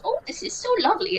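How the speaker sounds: put-on high, quick sweet old lady; dotty old lady. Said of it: "She's just acting like a dotty old lady".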